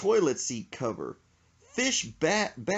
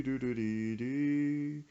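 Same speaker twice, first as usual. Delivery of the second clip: hummed organ music; 4 dB quieter, much slower than usual. Retold or imitated. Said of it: "sad organ church music".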